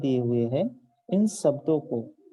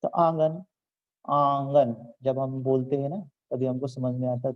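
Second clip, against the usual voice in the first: normal talking voice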